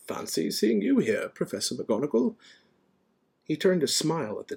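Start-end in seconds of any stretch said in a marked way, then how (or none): 0.1-2.4 lightly voiced genial wizard, higher pitch; pitched up, a hint of playful greeting; Dumbledore. A narrator's reading. Dumbledore greeting the cat, lightly playful. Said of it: "he spoke to it".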